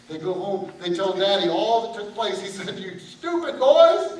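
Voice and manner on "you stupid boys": slow, loud, high drawn-out scolding; drawn-out loud dad scolding; angry father. Acted. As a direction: stretch out the insult like a fed-up dad.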